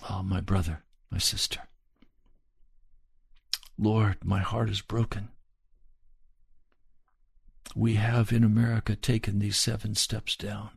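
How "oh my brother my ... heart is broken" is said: tender appeal. Real, not acted. Tender appeal after climax.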